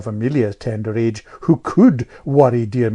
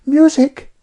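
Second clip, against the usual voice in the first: put-on high, louder piping girl; piping know-all child; 8 dB louder than usual. A narrator's reading. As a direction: pipe up like a little know-all. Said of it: "piped Amelia".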